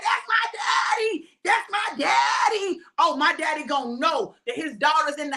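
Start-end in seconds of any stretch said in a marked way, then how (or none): 0.0-1.3 high, slow, shouted cheer for daddy; a proud daughter shouting from the crowd. Retold or imitated. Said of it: "I'll be like". 1.4-2.8 high, slow, shouted cheer with a sob in it; a daughter cheering her daddy near tears; proud cheer, breaking. Retold or imitated. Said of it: "I'll be like".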